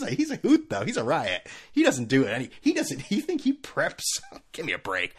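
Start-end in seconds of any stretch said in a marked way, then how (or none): none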